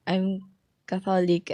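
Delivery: ordinary talk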